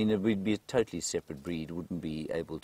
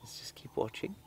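whisper; 7 semitones higher, 6 dB quieter than usual. Real, not acted. Quiet watching at wildlife sighting.

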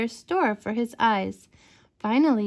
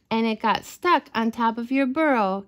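ordinary delivery

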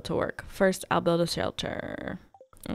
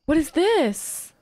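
surprised gasp; 10 semitones higher, 6 dB louder, slower than usual. Real, not acted. Gasp at discovery.

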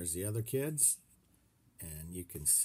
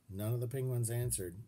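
ordinary delivery, nothing marked

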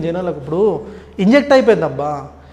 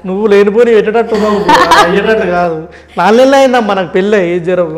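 louder, laughing through the words; laughing protest; 7 dB louder than usual. Real, not acted. Teased by host, laughing back. (నవ్వుతూ, గట్టిగా తప్పించుకుంటూ)

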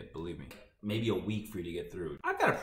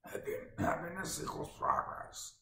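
higher, rough monster rasp; Cookie Monster voice; 9 semitones higher, 6 dB quieter than usual. Retold or imitated. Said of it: "It's more Cookie Monster".

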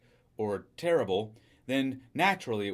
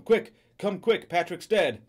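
loud, high, frantic plea; urgent cry; 6 semitones higher, 6 dB louder, slower than usual. A narrator's reading. Wife phones police about her dead husband, crying out urgently. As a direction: cry for help in a panic. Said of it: "she cried to him".

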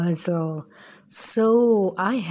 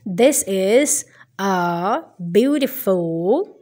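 usual speaking voice

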